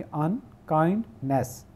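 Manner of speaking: Unkindness slowly, in three beats: un, kind, ness; three separate sounds. Shown as a demonstration. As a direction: separate each syllable of unkindness. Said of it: "हम तीन आवाज़ें निकालेंगे".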